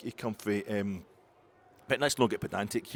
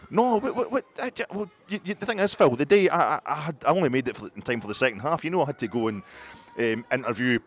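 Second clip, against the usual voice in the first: flustered excuses; 7 dB louder than usual. Acted. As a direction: blurt excuses loudly, flustered. Character loudly pleading excuses.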